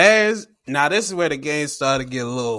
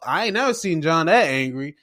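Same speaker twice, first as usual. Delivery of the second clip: usual speaking voice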